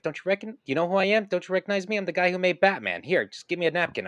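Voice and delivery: high, loud boastful brag; bragging Bob Kane. Retold or imitated. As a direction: play the pompous show-off creator. Kane bragging voiced in anecdote.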